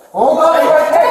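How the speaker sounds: high, loud shout to stop; yelled command. Real, not acted. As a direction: yell it so he freezes. Officer yelling at moving suspect.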